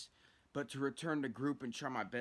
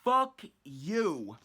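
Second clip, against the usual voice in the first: loud, raised-pitch curse; all-caps cursing; 5 semitones higher, 9 dB louder than usual. Acted. Teller curses the itch in his write-up, angry all-caps outburst. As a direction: read it out as if written in capitals. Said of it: "I put in all caps".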